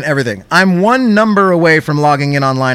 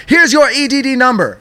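high, rapid curt delivery; fired off quickly; 8 semitones higher than usual. Retold or imitated. Agent curtly firing off the number. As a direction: rattle it off and be done. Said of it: "Fires it off".

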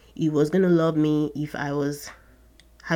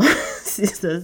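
normal talking voice

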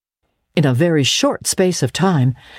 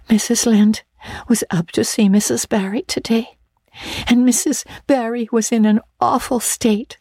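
wailing; 8 semitones higher than usual. Acted. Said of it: "she wailed".

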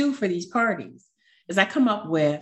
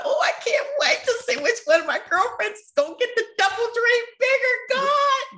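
much higher and fast, bubbling over with excitement; giddy excitement. Retold or imitated. Telling friends at her birthday dinner, giddy high excitement. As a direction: gush like you can't wait to see the surprise. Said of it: "I was so excited".

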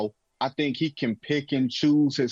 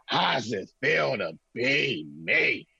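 drawn-out twang, slow and taunting; slow, twangy movie-villain taunt; Eddie King Junior; 3 semitones lower, slower than usual. Acted. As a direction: stretch each word with a heavy twang. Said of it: "he had a twang in his accent".

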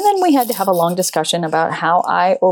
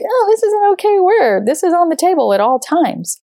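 breezy carefree voice; 10 semitones higher than usual. Pretend. Imagining how the word sounds okay, high and breezy. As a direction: say it like it's no big deal. Voicing a casual attitude.

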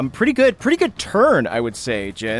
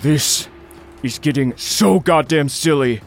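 lightly voiced fed-up villain; a touch of weary irritation in character; reluctant, mildly put-on villain voice; exasperated Nalar. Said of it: "he breathes steam out of his nostrils and goes".

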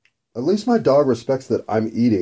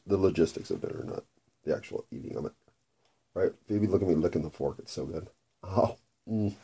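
much softer, murmured to the cat; hushed; 4 semitones lower, 10 dB quieter than usual. Real, not acted. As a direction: murmur it close and low. Soft talk while eating.

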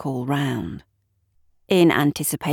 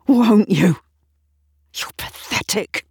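louder, higher, contemptuous outburst; rough self-contempt; 5 semitones higher, 7 dB louder than usual. A narrator's reading. Woman berating herself in the mirror, rough and contemptuous. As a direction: lash out with disgust. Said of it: "She steps forward roughly".

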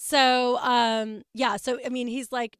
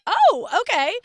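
mock surprise; 9 semitones higher, 12 dB louder, slower than usual. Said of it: "I'm like".

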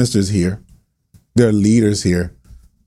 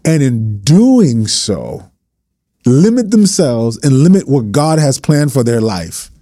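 impassioned preaching; 3 semitones higher than usual. Real, not acted. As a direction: preach it hard to pastors. Preaching continues.

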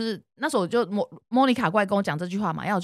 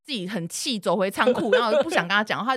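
ordinary delivery

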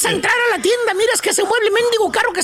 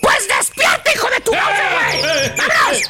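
sudden shout. Acted. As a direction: break the calm with a shout. Jolting the listener out of meditation.

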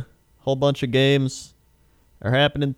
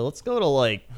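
ordinary delivery

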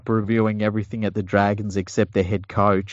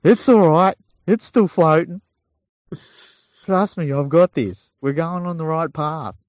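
much higher and louder, falsely cheery captain; sinking captain; 8 semitones higher, 3 dB louder than usual. Retold or imitated. Captain of the sinking Titanic soothing passengers, hammed-up cheer. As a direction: reassure everyone as the ship goes down. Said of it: "he's looking around at everyone going".

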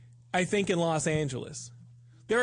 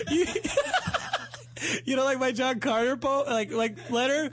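higher, laughing mock hurt; 9 semitones higher than usual. Real, not acted. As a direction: laugh through a wounded question.

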